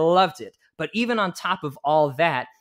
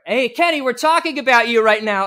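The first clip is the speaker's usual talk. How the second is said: loud, higher call across the green room; yelling across the room; 7 semitones higher, 8 dB louder than usual. Retold or imitated. John calls across the room to Kenny, loud yell. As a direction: call it out over the noise like John. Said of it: "John yells over".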